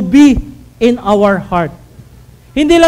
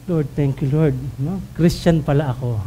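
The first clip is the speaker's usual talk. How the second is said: soft relieved prayer; 9 semitones lower, 8 dB quieter than usual. Pretend. Grateful relief after near sin.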